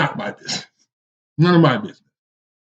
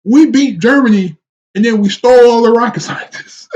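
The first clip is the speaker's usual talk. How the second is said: excited; 7 semitones higher, 6 dB louder than usual. Real, not acted. Amazed outburst about stolen scientists.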